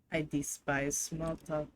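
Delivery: low, soft mock French purr of a haughty cat; snooty French cat. Player voicing the hostile cat in a mock French accent. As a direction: sneer it like a snobbish French cat.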